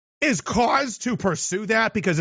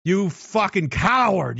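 ordinary talk

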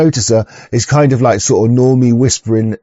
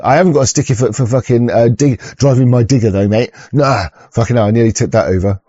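ordinary talk

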